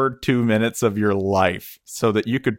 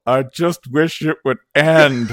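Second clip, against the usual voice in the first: loud, slow mock wail; hammed-up anguish, high and drawn out; agonized stall man; 6 semitones higher, 6 dB louder, slower than usual. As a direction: groan it out like a man in misery. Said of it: "hear the guy in the stall go".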